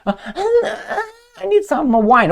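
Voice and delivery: high, slow, laughing falsetto, playing coy. The guest stalling the eager host, coy laughing falsetto. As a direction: drag it out in a giggling high put-on voice. Coy partner in mock seduction.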